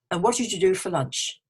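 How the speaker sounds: "what did you do for lunch" with a final fall; falling question. Shown as a demonstration. Asking a W H question, voice falling. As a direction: let "lunch" go down. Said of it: "usually your voice goes down".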